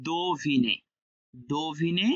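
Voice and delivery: "দোভিনে" with a long, clear do; o-vowel. Shown as a demonstration. Teacher models the rule, "দোভিনে" with an o sound. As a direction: open "দোভিনে" on do, not de. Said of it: "আর এখানে ওকার".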